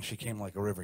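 ordinary delivery

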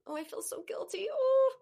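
anguished, rising to a high loud wail; feeling the guilt. Shown as a demonstration. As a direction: let the guilt pour out. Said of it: "See the difference?".

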